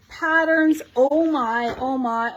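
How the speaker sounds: ordinary delivery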